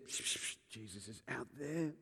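whispering. Retold or imitated. Martha whispering to Mary that Jesus has come, hushed. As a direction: lean in and whisper the news. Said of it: "And she says".

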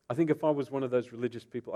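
usual speaking voice

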